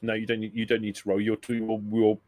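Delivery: normal talking voice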